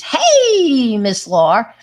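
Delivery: high, loud cheery "Hey, Miss Laura"; greeting the teacher. Retold or imitated. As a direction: call out a bright hello with a laugh. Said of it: "it was always".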